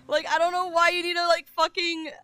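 fast frustrated rant. Real, not acted. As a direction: vent at chat. Ranting at chat.

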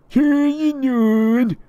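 very high, loud call; calling out in character. Acted. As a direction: holler it across the yard. Tyler calls Anon.

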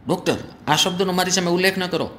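high, fast upset pleading; angry plea. Acted. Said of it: "એટલો બધો ગુસ્સામાં આવી ગયો". (ગુસ્સામાં ઝડપી વિનંતી)

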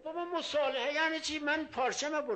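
high, loud, outraged protest; indignant shopkeeper. Retold or imitated. The shopkeeper protesting to the preacher, loud and outraged. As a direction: cry out like a merchant who was robbed.

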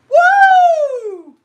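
high, loud whoop; excited woo. Real, not acted. Cheering at the cute ice cream sticker, whooping. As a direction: let out a joyful cheer.

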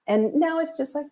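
ordinary talk, nothing marked

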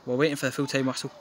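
ordinary talk, nothing marked

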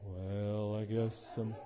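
low, soft, mopey Eeyore drawl; gloomy Eeyore. Retold or imitated. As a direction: sag into it like Eeyore. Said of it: "that character ... Eeyore".

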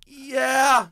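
high drawn-out yeah; mock meek admission; sheepish yeah. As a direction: squeak a guilty yeah. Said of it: "I'm just like".

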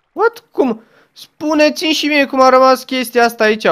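high, loud baffled outcry; surprised. Real, not acted. Player baffled by water stuck in the air, surprised outcry. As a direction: react as if the game broke. Weird water glitch appears.

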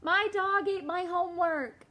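high, loud, put-on child voice; kid's excuse voice. David telling the teacher, high kid voice. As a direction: sell the lame excuse.

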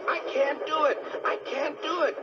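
high-pitched, desperate character cry; desperate engine. Acted. Engine losing steam on the hill, high desperate cry.

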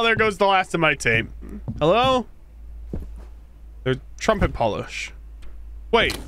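ordinary talk

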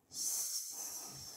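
soft, drawn-out "S" as sssss; hissed S sound. Shown as a demonstration. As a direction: let "S" trail as a voiceless sss. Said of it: "La lettre S chante". (« S » soufflé doucement : sssss)

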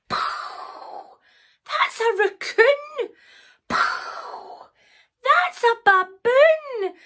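high, slow, insistent protest; exasperated Sarah. Acted. Sarah rejecting one wrong animal after another, exasperated. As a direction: stretch out the animal's name, high-pitched.

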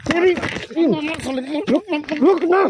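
angry yell. Acted. Staged street fight, grabbed by arm.

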